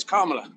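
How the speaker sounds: "Kamala" with stress on the first syllable, KAH-ma-la; correct name pronunciation. Shown as a demonstration. Teaching the interviewer her name: "Kamala" stressed on the first syllable. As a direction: say "Kamala" leaning on the opening KAH, the rest light. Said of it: "you have to emphasize the first syllable of her name".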